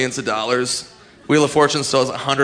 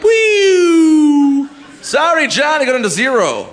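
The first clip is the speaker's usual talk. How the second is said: high, loud mock host, cheerfully breaking bad news; cheesy game show host; 13 semitones higher, 6 dB louder, slower than usual. Host telling the contestant he lost, in a hammed-up chirpy yell. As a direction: ham up a chirpy TV host announcing the loss. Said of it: "they're like".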